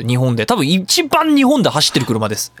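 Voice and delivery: ordinary talk, nothing marked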